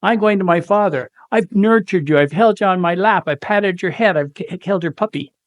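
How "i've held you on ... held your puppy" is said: ordinary talk, nothing marked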